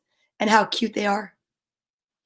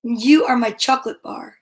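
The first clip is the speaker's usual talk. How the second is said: gentle read-aloud of a rhyming picture book; storybook reading. Acted. Parent reading a bedtime rhyme to a child, gentle and unhurried.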